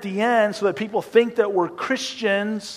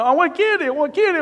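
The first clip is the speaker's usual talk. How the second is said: put-on whiny kid; 11 semitones higher, 3 dB louder, faster than usual. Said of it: "the lady at the checkout counter with the kid".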